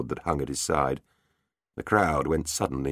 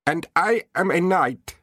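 higher, courtly knight voice; 12 semitones higher, 3 dB louder than usual. Acted. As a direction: announce yourself like a knight. Knight character voice.

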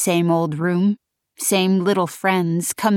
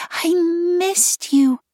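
high sing-song whisper; 12 semitones higher, much slower than usual. A narrator's reading.

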